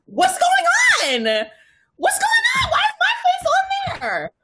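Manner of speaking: high, loud disbelieving shout; shocked yelling. Retold or imitated. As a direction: yell it in stunned disbelief. Retold shock at seeing face.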